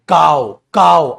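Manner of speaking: काओ drawn out slowly, loud; slow demo pronunciation. Shown as a demonstration. Teacher sounding out काओ slowly for students. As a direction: spell out काओ slowly for the class. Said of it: "आप इसको क्या पढ़ोगे".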